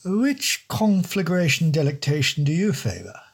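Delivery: high, plummy English don voice; wordy posh don. Acted. The don asking the detective's brand, high plummy voice. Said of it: "These dons were always wordy as hell".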